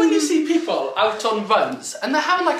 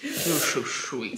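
low, soft, very slow coo; cooing pet talk; 8 semitones lower, 5 dB quieter, much slower than usual. Real, not acted. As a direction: echo the doting coo softly. Echoing the coo to the dog.

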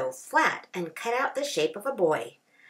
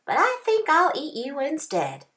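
roadrunner's voice; 8 semitones higher, 5 dB louder than usual. A narrator's reading. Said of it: "said the roadrunner".

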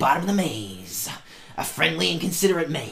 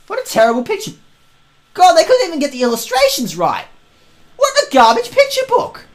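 high, rising indignant whine; mock outrage; 6 dB louder than usual. Real, not acted. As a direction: play up the snobby outrage. Mock outrage at picture book.

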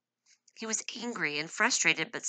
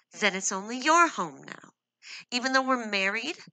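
higher and louder, tight in the throat; constricted voice; 6 semitones higher, 6 dB louder than usual. A narrator's reading. Wife stung by her husband's words, constricted. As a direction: squeeze the words out, hurt. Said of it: "she said in a constricted voice".

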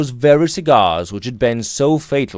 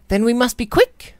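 put-on higher young woman's voice, brisk; brisk young lady; 13 semitones higher than usual. A narrator's reading. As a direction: hurry the gentlemen along. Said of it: "she answered".

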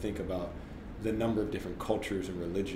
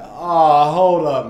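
loud disbelief; 7 semitones higher, 15 dB louder, much slower than usual. Retold or imitated. Reacting to being replaced.

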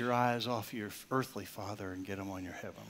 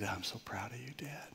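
soft, breathy-free whisper; gentle whisper; 9 dB quieter than usual. Retold or imitated. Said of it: "he said something gently".

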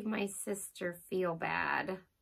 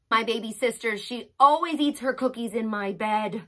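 usual speaking voice

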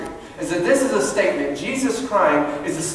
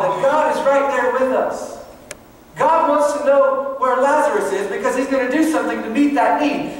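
raised, higher-pitched preaching voice; impassioned preaching; 6 semitones higher, 4 dB louder than usual. Real, not acted. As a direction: preach it with rising urgency. Preaches at full force.

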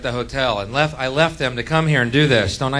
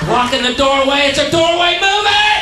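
very high, loud screamed rant; furious scream; 9 dB louder than usual. Acted. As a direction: scream at people blocking your way. Screams at tourists blocking doorway.

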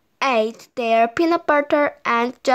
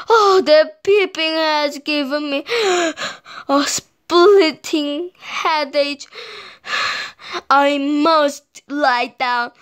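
exhausted complaint; 3 semitones higher than usual. Acted. Willie worn out by peeping chicks, gasping complaint.

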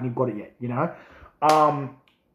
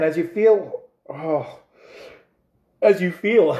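higher, struggling to get words out; struggling to speak; 4 semitones higher, 4 dB louder, slower than usual. Real, not acted. Reviewer overcome by heat, struggling to speak. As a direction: push the words out through the burn. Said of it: "if I can get the words out".

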